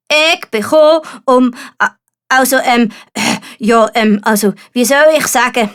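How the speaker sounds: hesitant, losing his nerve mid-announcement; nervous knight. A narrator's reading. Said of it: "verkündet". (nervöser, stotternder Ritter)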